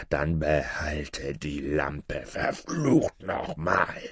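low, very slow, restrained howl; howling sorcerer. A narrator's reading. Sorcerer gives up on the lamp, low drawn-out howling curse. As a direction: curse him with long dragged-out words. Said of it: "Da heulte der Zauberer laut".

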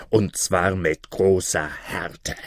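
low, slow stern chief; gruff captain vow; robber chief. Acted. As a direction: threaten with harshness.

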